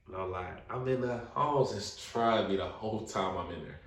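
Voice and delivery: normal talking voice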